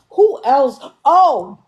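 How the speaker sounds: sudden bright realization; excited recall. Real, not acted. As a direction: pop up as the name comes to you. Remembering another name, loud.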